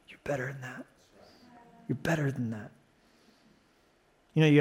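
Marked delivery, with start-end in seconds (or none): 0.1-2.7 much softer, tender and low; with love and compassion. Shown as a demonstration. Pastor gently telling the congregation they are better, much softer. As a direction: say it gently with mercy, not scorn. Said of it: "I say it with love".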